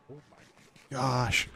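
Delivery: high, slow drawn-out gasp of shock. Real, not acted. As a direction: stretch it out in disbelief. Learns he is at five hearts.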